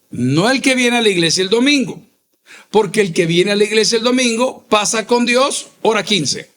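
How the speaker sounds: ordinary talk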